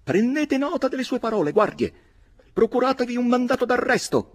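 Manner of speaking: strillo acutissimo e forte; grido dell'avvocatino. A narrator's reading. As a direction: grida ordini nascosto dietro le guardie. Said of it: "gridò l'avvocatino". (l'avvocatino strilla alle guardie, acuto e forte)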